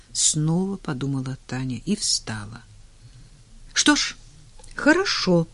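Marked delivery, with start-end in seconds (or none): 3.7-5.5 much higher, cheerful voice; brisk cheer. A narrator's reading. Doctor hiding bad news from her patient, cheerfully. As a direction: put on a bright, high-pitched confidence. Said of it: "бодро сказала она".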